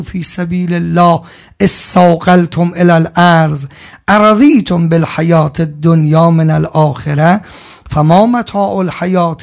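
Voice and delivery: slow, loud chanted recitation; Quran recitation. Real, not acted. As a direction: intone the verse slowly and fully. (تلاوت آهنگین قرآن)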